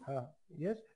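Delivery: usual speaking voice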